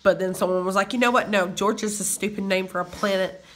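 usual speaking voice

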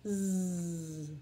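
Z as a long low buzz "zzz"; letter sound. Shown as a demonstration. Teacher drilling letter sounds, Z as "zzz". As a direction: buzz Z without a vowel.

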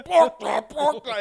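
loud, high squawked imitation; hammed-up shouted taunt; mocking mimicry. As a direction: parrot the customer through laughter. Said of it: "He's like".

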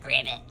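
low croaked ribbit; deep little frog croak. Shown as a demonstration. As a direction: imitate a frog for a child. Imitating frog from the device.

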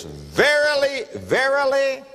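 "Verily, verily" drawn out slowly and pitched high; solemn, high emphasis; emphatic verily. Acted. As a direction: lean hard on each "verily". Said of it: "he is saying it for emphasis".

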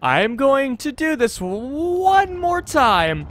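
high, slow, drawn-out declaration; exasperated resolve. Real, not acted. Player retrying for new dialogue, exasperated resolve. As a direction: stretch it out in exasperation.

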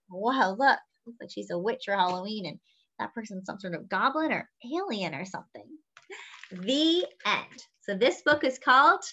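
usual speaking voice

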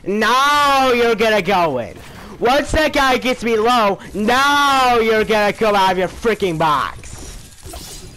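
loud, high furious rant; furious yelling. Real, not acted. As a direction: yell it out in real rage. Furious at player stealing kill.

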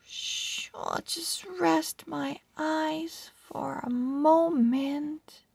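hushed, drowsy whisper; sleepy hushed voice. A narrator's reading. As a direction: whisper as if dozing off. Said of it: "the scrap pile was so comfy".

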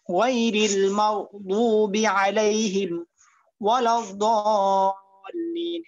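ওয়ালাদ-দাল্লিন with the long stretch held, recited very slowly; slow model recitation with long madd; long madd demo. Shown as a demonstration. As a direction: hold the madd in দাল্লিন for a full count. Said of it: "চার আলিফ টানা হয়নি".

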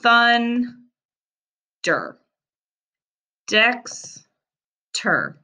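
slow, each word split into two parts; syllables split apart. Shown as a demonstration. Teacher to pupils: each word split into syllables. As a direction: break every word apart slowly. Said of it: "we break it up right in the middle".

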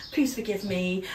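normal talking voice

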